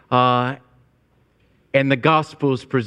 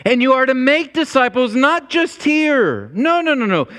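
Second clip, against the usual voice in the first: higher, building exhortation from the pulpit; preaching at full force; 7 semitones higher than usual. Acted. Pastor urging the congregation to reach every nation, fervent preaching. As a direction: lift the voice and drive the command home.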